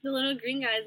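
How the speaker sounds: talking while chewing gum; words round a piece of gum. Real, not acted. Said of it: "sorry if I'm chewing gum".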